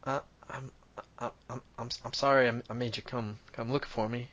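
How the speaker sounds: soft, stammering apology; sheepish little boy. Acted. Little boy apologizing to his rescuers, sheepish and halting. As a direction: stumble shyly over the words, very quietly. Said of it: "the, the little boy is kinda looking at his feet".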